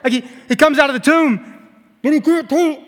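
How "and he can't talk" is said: high, bound-up mumble; muffled mummy. Acted. Preacher imitating wrapped Lazarus, muffled mummy voice. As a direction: talk as if wrapped in burial cloth. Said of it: "a mummy-like figure who's like this".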